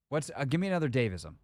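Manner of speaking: ordinary delivery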